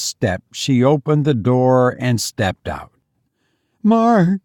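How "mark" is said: high, anxious woman's question; shakily voiced, fearful wife; shaky. A narrator's reading. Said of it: "she asked shakily".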